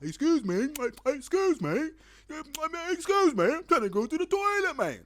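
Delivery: high, loud put-on accent yell; hammed-up high-pitched plea; screaming in an odd accent. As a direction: shout desperately over the music in a silly accent. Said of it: "Don't ask me about the accent".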